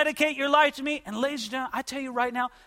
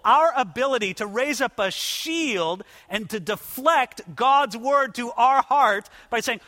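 usual speaking voice

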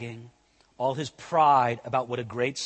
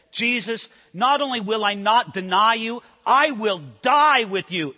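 louder, confident vow; boastful Peter; 6 semitones higher, 7 dB louder than usual. Retold or imitated. Preacher voicing Peter boasting to Jesus, loud and confident. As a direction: swear it proudly.

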